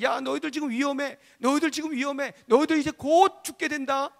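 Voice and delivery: high, loud, building alarm; insistent repeated warning. Acted. Neighbours warning the builders over and over of danger, urgent and insistent. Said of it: "반복하여서 계속해서".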